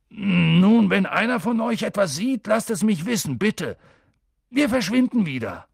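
loud, high, impatient sign-off; exasperated. A narrator's reading. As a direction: end the talk loudly, fed up. Hagrid gives up, irritated. (laut und genervt)

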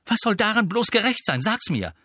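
high, exasperated challenge; indignant demand. Acted. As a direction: demand an answer about the injustice. Pupil rants about unfair punishment.

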